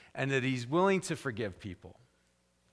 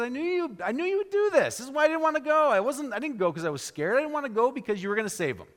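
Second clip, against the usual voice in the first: calling God out; 8 semitones higher, faster than usual. Acted. Jonah accuses God of sparing his enemies, shrill and pitched high. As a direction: throw it back at God like a cornered prophet. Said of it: "He calls God out".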